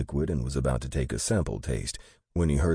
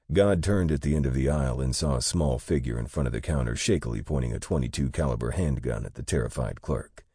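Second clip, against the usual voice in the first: plain reading voice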